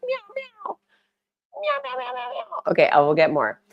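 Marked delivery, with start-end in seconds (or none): 0.0-2.6 very high, soft little meows; tiny mock cat meows; imitating a kitten. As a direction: mimic a kitten mewing. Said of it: "She was just like".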